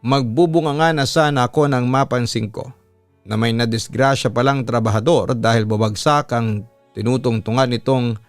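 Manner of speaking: ordinary delivery, nothing marked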